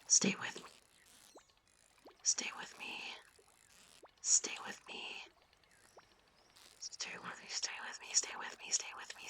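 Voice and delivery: whispered, fading pleas; mock dramatic whisper. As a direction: whisper as if to a dying comrade. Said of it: "mock dramatic losing the fish".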